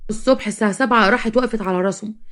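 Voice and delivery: plain reading voice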